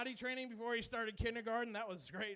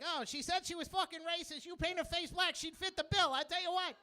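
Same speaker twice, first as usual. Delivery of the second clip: higher, fast-talking wiseguy impression; Joe Pesci; 6 semitones higher than usual. Comic imitating Joe Pesci cracking a joke, high wiseguy voice. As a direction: do a wiry New York wiseguy. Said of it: "Fucking Joe Pesci would be like".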